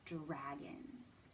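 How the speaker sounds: hushed whisper of the word. Shown as a demonstration. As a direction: breathe it like a secret. Teacher whispers the quiz word.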